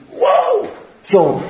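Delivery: wow shot way up in pitch; a sudden gasp of wonder at the nets; amazed cry. Acted. Peter amazed at the catch. (zdumiony okrzyk)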